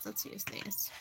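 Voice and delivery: whispered to herself; soft private whisper. Real, not acted. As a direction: breathe it to yourself while choosing cards. Whispering to herself over the deck.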